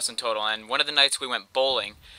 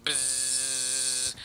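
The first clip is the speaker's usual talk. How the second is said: long, steady buzz through the lips; imitated buzzing; 9 dB quieter than usual. Storyteller imitating a mysterious buzz, long droning "bzzz". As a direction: imitate a small motor droning on. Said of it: "I hear this buzzing".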